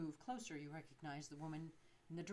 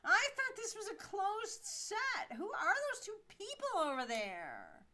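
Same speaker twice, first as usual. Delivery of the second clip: indignant actress; 4 dB louder than usual. Acted. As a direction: point at the strangers with outrage. Actress complains about intruders.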